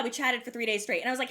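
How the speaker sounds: ordinary delivery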